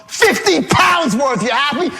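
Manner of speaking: high, drawn-out spiteful answer; retold irate shout; angry sarcasm. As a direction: hurl a mock confession at the caller. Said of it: "I'm really angry".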